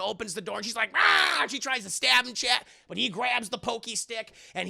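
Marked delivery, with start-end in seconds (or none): 0.9-1.4 sudden high mock scream; a short, startled shriek. Woman attacked with stick.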